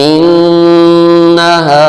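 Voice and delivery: انہا with the noon hummed long through the nose, very slow; nasal ghunnah. Shown as a demonstration. Teacher demonstrates ghunnah to students, slow nasal hum on انہا. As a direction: hold the nun of انہا in the nose for a while. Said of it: "ناک سے آواز نکالنی ہے".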